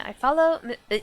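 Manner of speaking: ordinary talk, nothing marked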